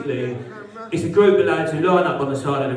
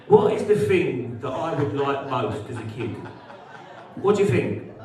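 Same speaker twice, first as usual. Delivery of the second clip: normal talking voice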